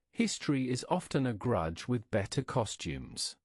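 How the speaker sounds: plain reading voice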